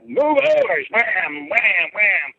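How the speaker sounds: high, drawn-out mock holler; acted-out farmer's yell. Farmer attacking a cow with a pitchfork, high mock yell. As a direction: reenact the blows with high, stretched-out exclamations.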